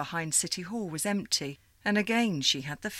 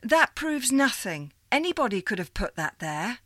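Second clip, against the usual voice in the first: louder, higher dismissive retort; skeptical retort; 6 semitones higher, 5 dB louder than usual. Acted. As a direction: shoot down the proof. Sylvie rejects proof.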